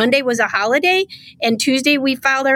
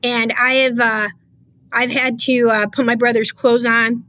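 ordinary delivery

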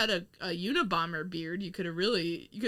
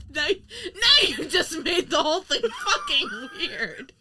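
laughing; 8 semitones higher, 5 dB louder, slower than usual. Real, not acted. Laughing protest at weird pitch.

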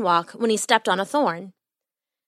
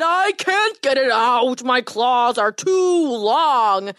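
slow, drawn-out lament; anguished wail; 3 semitones higher, much slower than usual. A narrator's reading. Lion struggling with a thorn in his paw, wailing. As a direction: wail helplessly, stretching the words. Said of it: "he cried".

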